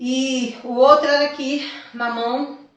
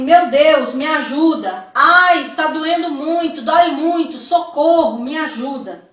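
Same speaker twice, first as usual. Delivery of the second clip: acted pleas for help, mildly raised; mock desperate cries; 4 semitones higher, 6 dB louder than usual. Victims begging God for help, mildly acted desperate cries. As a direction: cry out in pain, kept restrained. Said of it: "Pessoas gritavam assim".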